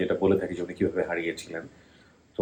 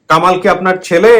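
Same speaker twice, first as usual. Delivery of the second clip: to someone hard of hearing; 11 semitones higher, 15 dB louder than usual. Real, not acted. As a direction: call it out to a deaf aunt. খান একটু শোনেন কম.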